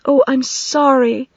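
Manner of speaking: slow, high, earnest apology; mean the apology. A narrator's reading. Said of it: "Katie said earnestly".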